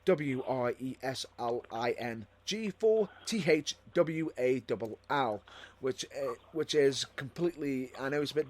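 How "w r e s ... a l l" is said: slow, letter by letter; spelling it out. Shown as a demonstration. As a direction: spell each letter out carefully. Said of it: "which I'll spell it out for you".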